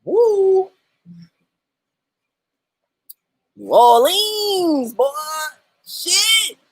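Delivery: high, stretched-out hype shout of New Orleans; hyped tourist cheer; excited whoop. Retold or imitated. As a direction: whoop it up like you just arrived. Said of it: "I was like".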